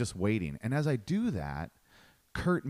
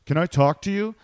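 louder, higher confrontational demand; imitating a huge guy calling the comedian out; big guy challenge; 7 semitones higher, 9 dB louder than usual. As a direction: square up, loud and blunt. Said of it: "he just goes".

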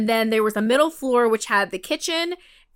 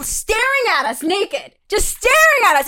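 ordinary talk

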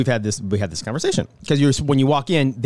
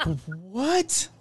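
soft, high rising incredulity; stunned, quietly pitched up; mock disbelief; 5 semitones higher, 9 dB quieter than usual. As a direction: draw out a hushed, high-pitched question. Mock disbelief at the clerk's remark.

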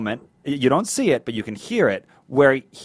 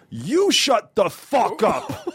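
slow, weighty threat leaning hard on fuck; stress on fuck; 6 semitones higher, much slower than usual. Retold or imitated. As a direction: drag it out and hit fuck like a man about to swing. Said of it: "And the emphasis on fuck".

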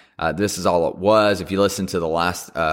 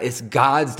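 ordinary delivery

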